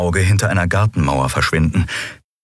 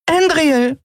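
joyful call. Acted. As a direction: call out her name in relief. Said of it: "rief Nelen aus".

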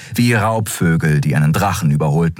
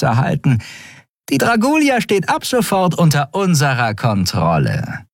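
much higher, smug and triumphant; gloating traitor; 9 semitones higher than usual. A narrator's reading. Traitor gloating to the admiral about seizing the ship. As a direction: savour taking his ship.

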